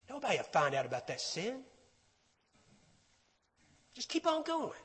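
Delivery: hushed, sly coaxing; secretive tempter. Acted. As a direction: drop to a soft conspiratorial tone and tempt them. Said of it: "The voice of the liar is trying to tell you".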